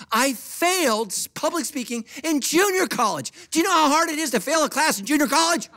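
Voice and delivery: high-pitched comic exasperation; incredulous preaching. Real, not acted. Preacher confessing failure to church, high and incredulous. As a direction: crank it up high for the punchline.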